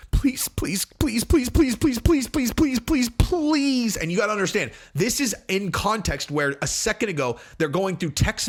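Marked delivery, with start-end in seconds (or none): 0.1-4.0 high, rapid-fire hammed-up pleading; mock frantic begging. Wife begging him not to go, mock frantic. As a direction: beg desperately in a put-on high voice.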